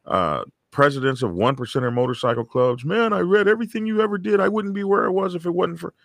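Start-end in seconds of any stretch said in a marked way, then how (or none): 2.8-5.9 excited quote. Retold or imitated. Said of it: "someone sending me an email or a text messages".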